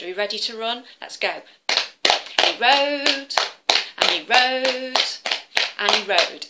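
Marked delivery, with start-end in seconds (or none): 1.7-6.5 drawn-out, louder storyteller chant; slow dramatic telling. Shown as a demonstration. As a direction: stretch each word like a long ride. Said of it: "dramatic storytelling repetition".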